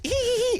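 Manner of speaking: put-on high squeak of a caught kid; very high playful squeal; mock squeal. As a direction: squeak like you got caught. Imitating wife caught peeking.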